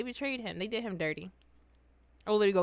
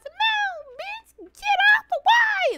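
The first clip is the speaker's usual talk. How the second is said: very high squealed rap hook; screaming chipmunk; 6 dB louder, much slower than usual. Retold or imitated. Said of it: "It sounded like a damn chipmunk".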